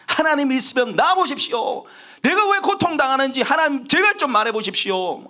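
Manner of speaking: high, insistent challenge to God; defiant reproach. Acted. Suffering Job challenges God to answer, defiant and resentful. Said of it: "하나님께 막 대들고 하나님께 막 원망하기".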